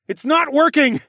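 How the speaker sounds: high, loud yell; frantic yell. A narrator's reading. Yelling to a companion while fleeing monsters, loud and high. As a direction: yell it out in frustration. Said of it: "I yelled".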